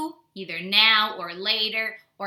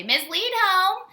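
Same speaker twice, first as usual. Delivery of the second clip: higher, slow mocking singsong; imagined kids mocking her, higher and slow; teasing; 11 semitones higher, slower than usual. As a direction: tease the teacher like a snickering kid. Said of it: "laugh at me and say".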